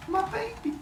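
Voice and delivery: high, soft, stricken squeak; hammed-up heartbroken whimper; mock horrified mother. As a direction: play a mother aghast, high and small. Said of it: "Rhea freaks out".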